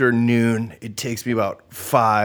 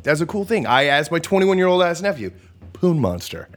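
ordinary delivery

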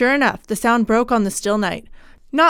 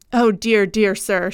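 ordinary delivery

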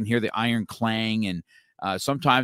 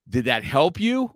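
normal talking voice

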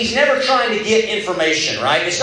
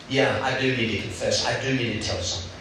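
sheepish confession; 7 semitones lower, 6 dB quieter than usual. Retold or imitated. Caught student finally confesses.

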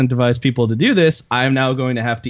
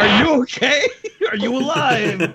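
laughing; 8 semitones higher than usual. Real, not acted. Laughing at friend eating pepper.

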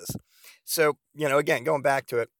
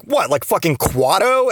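loud, pitched-up incredulous comeback; incredulous question; 6 semitones higher, 6 dB louder than usual. Retold or imitated. Boyfriend answering her growing-on-me idea, incredulous. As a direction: throw it back at her in disbelief. Acts out incredulous reply to partner.

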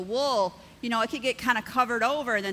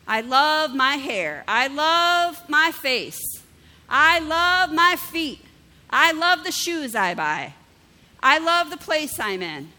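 slow, louder and higher, proclaiming each line; loud self-affirmation; 4 semitones higher, 6 dB louder, slower than usual. Pretend. Pastor shows the congregation how to praise themselves, slow and loud. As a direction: declare it to the mirror with full conviction. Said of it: "Can you stand before the mirror and say".

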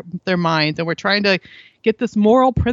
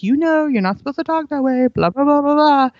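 higher, nagging lecture voice; lecturing parent; 6 semitones higher than usual. Retold or imitated. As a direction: nag like a preachy parent. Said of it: "we try to lecture them".

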